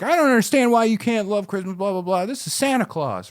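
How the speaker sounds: high-pitched indignant protest; mock angry. Marty berating Biff over Christmas, mock-angry high protest. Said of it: "He's so angry about this".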